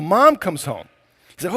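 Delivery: usual speaking voice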